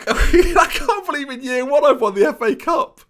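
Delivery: high, giddy laughing voice; laughing disbelief. Real, not acted. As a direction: laugh it out, can't believe it.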